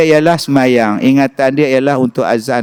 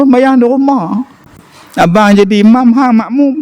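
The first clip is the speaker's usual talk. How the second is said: "Sembahyang di rumah" said much higher, petulant; sulky insistence; 8 semitones higher than usual. Retold or imitated. A sulking husband insisting to his wife he'll pray at home.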